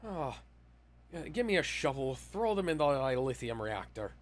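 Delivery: high, frantic Scottish engineer; hammed-up Scottish ship's engineer shouting up to the captain; Scottish accent. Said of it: "the worst Scottish accent I've ever put on".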